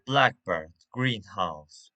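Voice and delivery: "blackbird, greenhouse" strong at the start, falling after; stress on first part. Shown as a demonstration. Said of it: "stress is on the first part".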